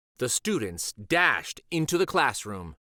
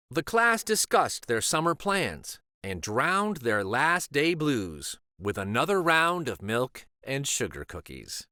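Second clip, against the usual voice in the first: usual speaking voice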